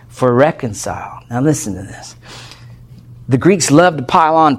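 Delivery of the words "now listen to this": whisper. Real, not acted. Preacher leans in to congregation.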